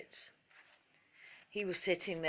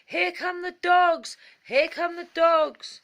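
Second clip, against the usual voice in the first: much higher, loud bird's alarm call; warning cry; 11 semitones higher, 11 dB louder than usual. Acted. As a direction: call out to scare off the fox.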